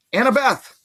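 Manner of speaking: high, loud call of her name. Acted. Percy calling Annabeth away from the game, loud and high.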